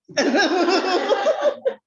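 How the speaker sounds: loud high burst of laughter; hearty laugh. Real, not acted. Preacher laughing at his own joke with the congregation, loud high laugh.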